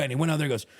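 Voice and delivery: normal talking voice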